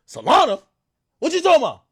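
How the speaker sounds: high, loud puzzled echo; mock confusion. Confused reply to Drake on the phone, high and loud. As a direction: ask it high like the name means nothing.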